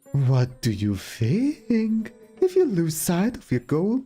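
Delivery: fortune teller. Pretend. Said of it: "Fortune telling".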